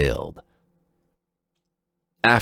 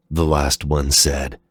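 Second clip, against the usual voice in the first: usual speaking voice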